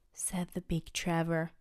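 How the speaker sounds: ordinary delivery, nothing marked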